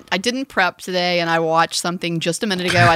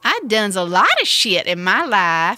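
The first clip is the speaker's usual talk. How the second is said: slow, hammed-up Southern drawl in a mock quote; mocking the actress's bad Southern accent; 3 semitones higher, 4 dB louder than usual. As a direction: put on a terrible Southern accent. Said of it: "it's a really terrible Southern accent".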